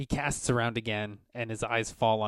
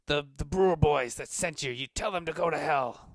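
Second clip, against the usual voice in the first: raised, higher angry retort; defiant curse; 5 semitones higher, 5 dB louder than usual. Acted. Merchant snarls defiance at the player character, raised and angry. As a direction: spit it back at the intruder.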